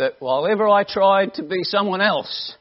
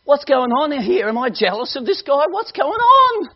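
bewildered plea to God; 8 semitones higher, 5 dB louder than usual. Retold or imitated. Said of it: "And I'm saying to the Lord".

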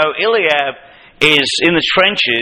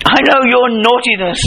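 higher accusing voice; Eliab accusing; 8 semitones higher than usual. Retold or imitated. Eliab accusing David of wickedness, higher accusing. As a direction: press the charge home. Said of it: "He says".

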